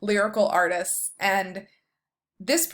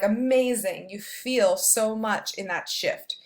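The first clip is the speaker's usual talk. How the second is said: ordinary talk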